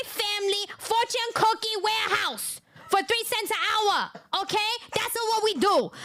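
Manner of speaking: high-pitched broken-English scolding; mocked angry mother. Mocking the mother threatening the father over child support, high accented rant. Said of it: "She's gonna be like".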